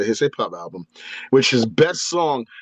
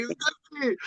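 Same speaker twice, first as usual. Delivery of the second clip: high, breathless starstruck gasp of a name; starstruck squeal; 8 dB quieter than usual. Retold or imitated. Fan meeting Dusty Rhodes at the radio station, starstruck squeal. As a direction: gush the name like a dazzled fan.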